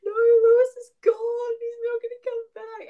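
hammed-up grief over a TV character; mock wail. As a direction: wail it like a heartbroken teen. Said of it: "And I was like".

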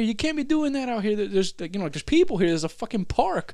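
high, scolding voice of the woman; scolding lady. Retold or imitated. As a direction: scold him like a stern passerby. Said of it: "and she".

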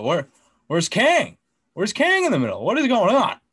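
indignant fan demanding where Kang is; outraged disbelief. Retold or imitated. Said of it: "I'm like".

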